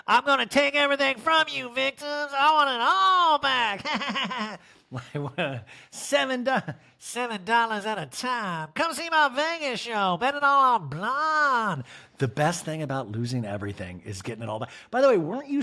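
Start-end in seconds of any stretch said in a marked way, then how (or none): 0.1-4.6 evil villain voice. Retold or imitated. Villain-style mockery, laughing. 5.9-11.8 mock showgirl voice.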